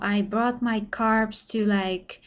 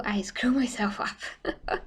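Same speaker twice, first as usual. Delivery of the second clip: laughing; 4 dB quieter, slower than usual. Real, not acted. Laughing at her own mishap.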